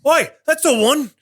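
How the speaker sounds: high, put-on Cockney-ish "Oi" barfly; rowdy local drunk. Drunk sailor pointing out the hero to the crowd, rowdy high yell. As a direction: shout it like a tipsy sailor. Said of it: "familiar voice goes".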